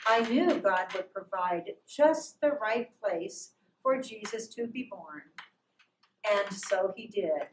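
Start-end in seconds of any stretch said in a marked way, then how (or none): none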